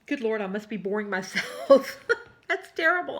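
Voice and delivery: chuckling through the words; laughing. Real, not acted. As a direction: laugh at yourself while talking. Laughs at herself mid-chat.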